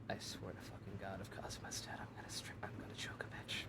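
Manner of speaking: whispered murderous rage; furious whisper. Real, not acted. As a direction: hiss the threat under your breath.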